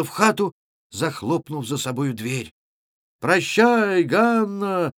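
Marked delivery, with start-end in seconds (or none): 3.2-4.9 high, loud drawn-out call; shouted farewell. A narrator's reading. Lad sneaking up to bid Ганна goodbye, shouting. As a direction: call it out teasingly, stretching the words. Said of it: "закричал в это время один из парубков".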